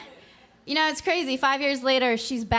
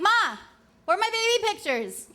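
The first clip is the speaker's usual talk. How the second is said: retold yell up the stairs; 9 semitones higher than usual. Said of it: "I scream up the stairs".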